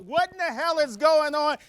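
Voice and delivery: high-pitched mock holler; retold heckler scream. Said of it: "when she screamed out".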